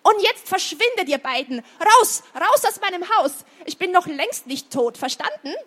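put-on high, loud angry old lady; outraged shouting, peaking on "Raus"; furious. A narrator's reading. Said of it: "wütend die Hand".

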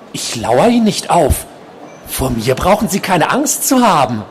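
high-pitched uncanny patient voice; creepy stalker, warning. A narrator's reading. Patient warning the nurse alone in the dark, high creepy voice. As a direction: warn her in a high, insistent voice.

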